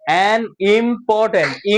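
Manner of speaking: an important slowly, im-por-tent; measured teacher's an important; separate syllables. Shown as a demonstration. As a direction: sound out important piece by piece. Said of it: "আলাদা আলাদা করে উচ্চারণ করবা".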